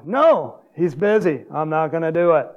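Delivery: acted emphatic refusal; retold stubborn refusal. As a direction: reenact a stubborn husband refusing help. Acts out refusing his wife's suggestion.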